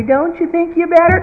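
high pleading parent; weak parent. Retold or imitated. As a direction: ask it up high, unsure. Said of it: "and we say".

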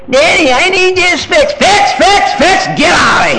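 put-on high pleading kid voice, shouted; mock child's plea. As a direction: squeal it like a child begging. Child pleads, father yells back.

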